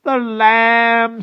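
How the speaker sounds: high, loud, relishing witch; Madam Mim. A narrator's reading. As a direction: gloat over your prey as a witch.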